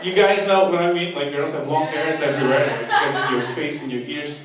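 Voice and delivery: ordinary delivery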